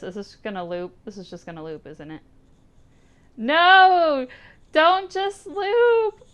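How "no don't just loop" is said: high, loud cry of protest, dragged out slowly; anguished cry. Real, not acted. Streamer protesting the looping ending, crying out loud. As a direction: yell out in real dismay.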